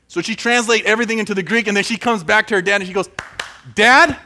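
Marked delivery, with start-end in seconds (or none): 3.8-4.2 loud high call across the house. Acted. Daughter calls her father to show her study, loud high call. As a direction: shout for dad to get his attention.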